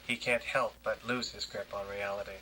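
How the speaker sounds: ordinary talk, nothing marked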